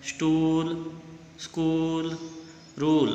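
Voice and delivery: "स्टूल, स्कूल, रूल" drawn out on a steady oo; long oo. Shown as a demonstration. Teacher shows pupils the long vowel, oo held in "स्टूल, स्कूल, रूल". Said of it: "बड़े ऊ का sound आ रहा है".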